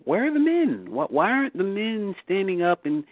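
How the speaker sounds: high-pitched puzzled questioning; acted-out bewildered question; wondering aloud. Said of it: "you sit back and you wonder".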